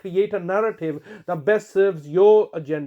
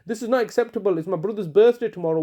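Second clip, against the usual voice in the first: usual speaking voice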